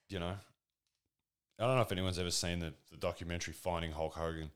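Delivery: ordinary talk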